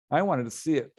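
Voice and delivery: normal talking voice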